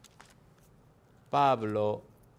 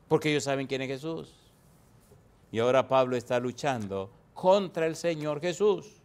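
usual speaking voice

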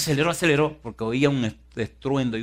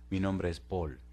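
low, soft, steady answer; acted calm rescuer; 4 semitones lower, 7 dB quieter, slower than usual. Fireman introduces himself to trapped woman, low and calm. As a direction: lower the voice and answer gently.

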